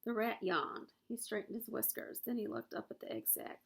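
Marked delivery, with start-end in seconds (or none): none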